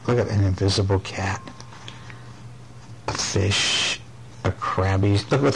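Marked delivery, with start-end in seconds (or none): none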